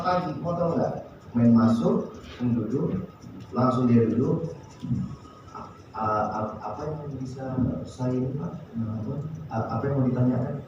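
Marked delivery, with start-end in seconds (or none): 6.0-10.6 low, soft and grudging, stumbling over the words; rude, indifferent. Retold or imitated. Impolite salesman mumbling at the boss, low and grudging. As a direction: mumble it like you can't be bothered. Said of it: "Dia langsung seperti itu".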